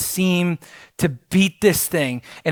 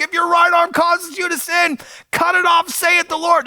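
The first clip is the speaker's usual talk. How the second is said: mock shout, pitched much higher; retold shouting; 11 semitones higher than usual. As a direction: imitate a man yelling scripture. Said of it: "literally shouting this verse at the top of his lungs".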